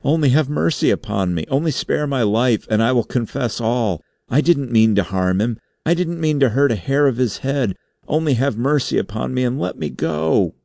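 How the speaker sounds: high, wailing plea for mercy; frightened begging. A narrator's reading. Suspect on his knees confessing to the board, begging. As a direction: beg desperately for your life. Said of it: "the frightened wretch fell to his knees".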